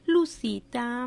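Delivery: ordinary talk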